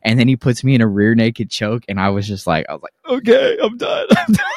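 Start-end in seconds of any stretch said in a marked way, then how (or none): 3.2-4.6 very high, strangled laughing giving up; shrieking laugh tapping out; laughing surrender. Retold or imitated. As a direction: squeal it out while laughing.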